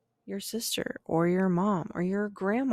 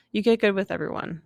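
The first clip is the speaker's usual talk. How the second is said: faster, evenly pushed restatement; quick emphatic repeat; 4 dB louder, faster than usual. Real, not acted. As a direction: restate it briskly and firmly.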